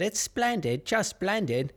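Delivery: put-on high, eerie little-girl voice saying "splendid"; high haunted-child voice, slow and sweet; creepy little girl. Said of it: "She just repeats that".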